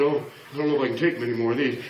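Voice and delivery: low, hurried mock weariness; drained. Drained mentor thinking of the next one, mock weariness. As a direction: play worn-out, pitch dropped. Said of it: "all life out of you".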